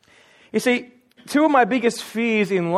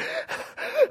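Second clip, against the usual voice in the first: wordless mock panting; imitated gasping; 7 dB quieter than usual. Runner who bolted after the truck, imitated out-of-breath gasps. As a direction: gasp for air as if after a run. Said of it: "I'm gasping, like".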